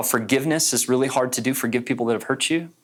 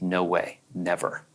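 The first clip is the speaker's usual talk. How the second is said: low, quieter retold refusal; tightened up; 5 semitones lower, 5 dB quieter, slower than usual. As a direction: shut it down tightly, voice dropped. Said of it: "she just tightened up and said".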